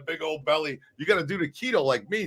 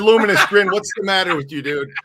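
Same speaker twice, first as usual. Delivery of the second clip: loud retort; 7 dB louder than usual. Retold or imitated. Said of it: "I said".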